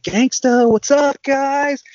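high, loud hammed-up movie quote; imitating McLovin. Quoting McLovin's cocky entrance.

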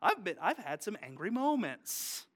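ordinary talk, nothing marked

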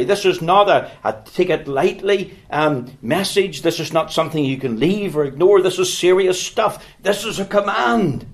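rising sermon urgency; fervent preaching. Acted. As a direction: press the call to repent home. Preaching builds in intensity.

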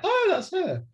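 high, delighted "oh, that's her"; surprised recognition. Retold or imitated. Recalling spotting the actress, high surprised squeal. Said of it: "And I was like".

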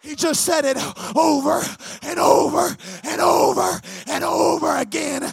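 loud, emphatic pulpit delivery; full-force preaching. Real, not acted. Preacher urging the congregation to commit, full-force preaching. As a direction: preach it out hard, punching key words.

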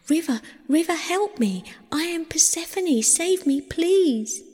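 high, urgent plea for rescue; crying out. A narrator's reading. Persephone calls on the river to save her, desperate high cry. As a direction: beg desperately, pitched high. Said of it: "Persephone cried out".

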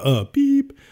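high-pitched beep sound; imitated beep. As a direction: chirp it like a phone beep. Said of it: "it just plays the".

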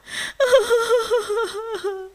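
high, loud wailing sob; acted long boo-hoo sobs; crying. Rabbit cries under a tree, high wailing. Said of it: "ra gốc cây ngồi khóc".

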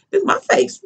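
high, hushed disbelief; a light mock of a stunned high voice; high stunned protest. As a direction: squeak it out in shock. Retold reaction to being grabbed.

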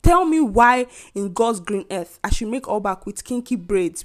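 normal talking voice

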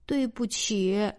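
slow, sulky apology; small, aggrieved piglet; wronged, hurt. A narrator's reading. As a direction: apologize as if unfairly blamed. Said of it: "波兹委屈地叫道".

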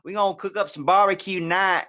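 a big country cowboy announcing barbecue; Southern cowboy with a pig in his arms. Retold or imitated. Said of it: "the giant cowboy guy".

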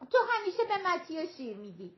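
high-pitched acted grievance; high little-girl protest; child's complaint.

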